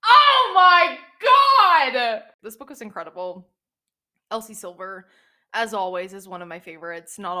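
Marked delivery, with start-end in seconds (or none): 0.1-2.2 very loud, high, drawn-out squeal; fangirl scream. Real, not acted. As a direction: scream it slowly, overwhelmed by the scene. Gushing over a favourite romantic scene.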